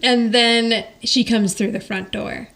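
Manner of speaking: usual speaking voice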